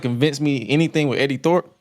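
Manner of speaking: ordinary talk, nothing marked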